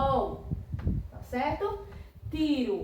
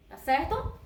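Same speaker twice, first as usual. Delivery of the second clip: normal talking voice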